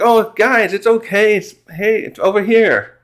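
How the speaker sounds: a cheerful shout across to the crew; Luffy calling out. Retold or imitated.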